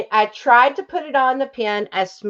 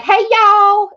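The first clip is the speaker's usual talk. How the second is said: loud, high, bright-energy "Hey, y'all." with y'all stretched up; signature greeting; 10 semitones higher, 5 dB louder than usual. Shown as a demonstration. Greeting new subscribers with her catchphrase, loud and high-pitched. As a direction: belt out "Hey, y'all." like opening the show. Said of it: "you get to hear me go like".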